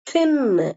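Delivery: தின்னு with a hard, voiceless th; firmly pressed TH. Shown as a demonstration. Teacher demonstrating strong th for learners, pressed. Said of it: "நல்லா அழுத்தி உச்சரிக்கிற".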